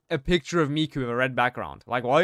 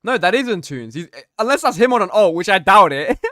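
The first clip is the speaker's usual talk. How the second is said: loud, higher, laughing pushback; amused protest; 5 semitones higher, 9 dB louder than usual. Real, not acted. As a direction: protest with a grin. Amused denial to chat.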